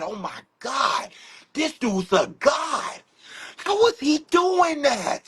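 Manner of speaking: slow, rising cries of amazement; awestruck child. Retold or imitated. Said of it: "when I was seven years old".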